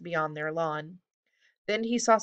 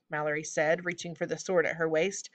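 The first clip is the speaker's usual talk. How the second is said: ordinary delivery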